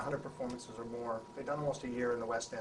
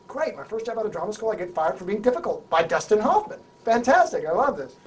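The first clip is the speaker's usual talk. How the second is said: loud higher mock-cheerful sarcasm; sarcastic despair; 9 semitones higher, 15 dB louder than usual. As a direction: moan ironically about your bad luck. Sarcastic self-pity.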